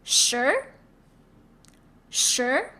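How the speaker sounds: "Sure" gliding upward like a question; second tone. Shown as a demonstration. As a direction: model "Sure" with a rising tone, R barely reached. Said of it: "Now let's give it a second tone".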